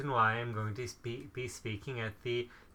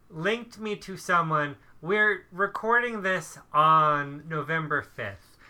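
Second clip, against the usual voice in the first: ordinary delivery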